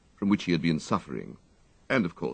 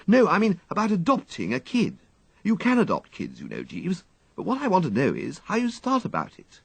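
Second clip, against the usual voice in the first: higher, rambling upper-class storytelling; eagerly recounting a play to his valet; breezy wistful gentleman; chatty young toff; 12 semitones higher than usual. A narrator's reading. Wooster's voice in story.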